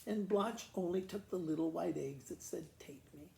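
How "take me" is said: usual speaking voice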